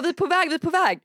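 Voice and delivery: mycket högre, jäktad härmning; härma försenade kompisar som lugnar; stressad försäkran. Retold or imitated. Härmar sena kompisar i telefon. (stressad försäkran)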